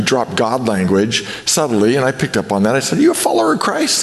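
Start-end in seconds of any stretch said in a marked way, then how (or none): 3.0-4.0 very high, quick eager question; acted excited asking, fast and high. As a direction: re-enact a delighted discovery, pitch leaping up. Retelling eager phone exchange with believer.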